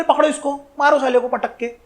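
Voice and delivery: quiet, understated call to attack. Retold or imitated. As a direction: give the order under your voice. Retold order to seize the envoy.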